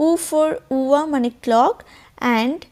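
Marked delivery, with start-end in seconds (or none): none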